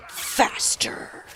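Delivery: low breathy whispered threat; menacing whisper. Retold or imitated. Niece glaring up at uncle, demanding he speed up, whispered menace. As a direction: whisper it like a creepy little villain. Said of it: "glares up and goes".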